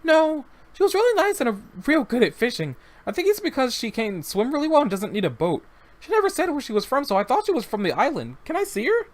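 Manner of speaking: put-on high, eager boy's voice; the child defending his friend, begging to see her; young boy, insisting.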